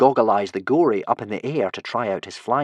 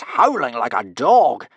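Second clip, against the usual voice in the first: drawn-out howling; 5 dB louder, slower than usual. A narrator's reading. Narrator dramatises Norbert howling.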